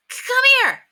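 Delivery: high, loud call. A narrator's reading. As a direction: call her over from the window. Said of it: "called Henry".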